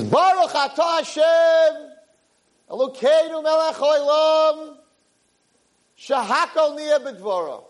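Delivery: high, slow, crying-out prayer; fervent blessing. Acted. Exhausted father looking up to Shemayim, fervent high blessing. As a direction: lift the blessing up to heaven with full heart.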